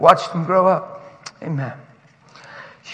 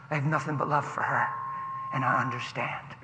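soft, low-key soothing voice; calm reassurance; 8 semitones lower, 13 dB quieter than usual. Retold or imitated. Passenger reassuring girl's dad on the plane, soft and calm. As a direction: gently put a stressed parent at ease.

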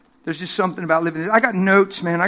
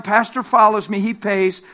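ordinary talk

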